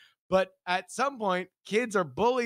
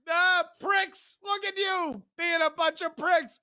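high mock shout; hammed-up, high-pitched name-calling; childish taunt; 10 semitones higher than usual. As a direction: jeer at bullies in a high kid voice.

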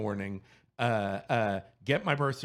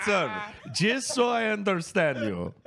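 put-on high-pitched Indian accent, louder; 7 semitones higher, 3 dB louder than usual. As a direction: imitate an Indian agent politely checking. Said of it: "okay, I will do the accent".

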